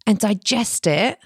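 ordinary talk, nothing marked

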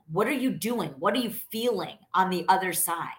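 normal talking voice

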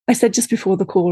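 ordinary talk, nothing marked

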